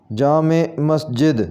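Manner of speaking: जामे मस्जिद slowly, with two-syllable 'jaa-me'; correct pronunciation. Shown as a demonstration. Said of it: "सही इसका तलफ़ुज़ होगा". (जामे मस्जिद में 'जामे' को 'ए' पर ख़त्म करें)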